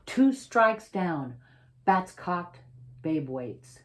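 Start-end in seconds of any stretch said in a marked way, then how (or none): none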